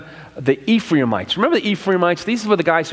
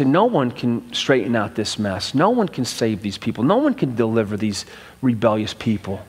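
despairing lament; 6 semitones lower than usual. Retold or imitated. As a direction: sink into resignation. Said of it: "we're gonna say".

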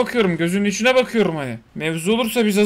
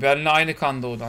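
ordinary talk, nothing marked

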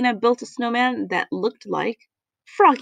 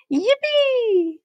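very high, long-held cheer; gleeful yell; 13 semitones higher than usual. A narrator's reading. Froggy cheering at building a snowman, gleeful yell. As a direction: whoop it high and stretch it out. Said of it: "yelled Froggy".

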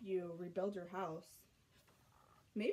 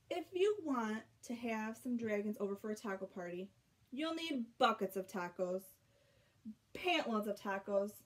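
usual speaking voice

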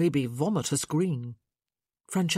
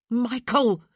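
exasperated shout; 7 semitones higher, 6 dB louder than usual. Acted.

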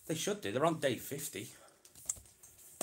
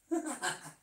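amused laugh; 11 semitones higher than usual. Real, not acted. Laughs at giraffe joke.

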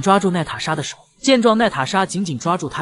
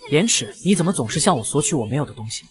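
ordinary delivery

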